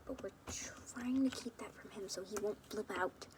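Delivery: whispered so he won't hear; hushed aside; secretive whisper. Acted. Keeping secret from Leorio.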